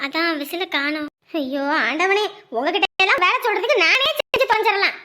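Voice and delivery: ordinary delivery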